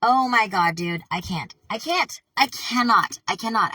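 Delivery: loud, high outburst of disbelief; losing it. Real, not acted. Reader balking at the next line, losing it. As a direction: burst out, overwhelmed and refusing. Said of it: "lose my shit right now".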